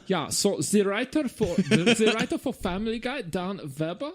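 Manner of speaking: high, laughing mock German accent. Host reads the writer credits in mock German accent. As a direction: play a hammy German presenter. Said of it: "You say you can't do a German accent".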